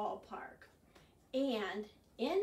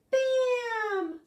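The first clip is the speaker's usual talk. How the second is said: very high, loud bam; shrieked sound-effect bam; shouted bam; 12 semitones higher, 12 dB louder than usual. Acted. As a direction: burst out like a popping pan. Rhyme punchline sound.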